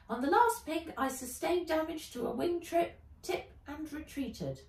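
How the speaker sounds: high, loud robotic voice; robot informant. Acted. Robot informant reporting to Ivy, high and loud. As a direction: report like a little machine.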